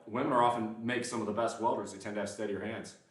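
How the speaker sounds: ordinary talk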